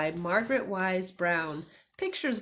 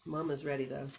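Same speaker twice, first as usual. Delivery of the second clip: ordinary delivery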